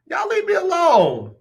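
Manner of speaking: high squeaky plea; playful plea. Real, not acted. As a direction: beg them off in a high voice. Laughing off the chat.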